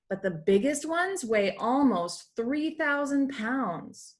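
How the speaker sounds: usual speaking voice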